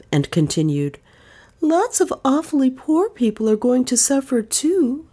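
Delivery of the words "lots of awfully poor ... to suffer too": wistful. Acted. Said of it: "she said wistfully".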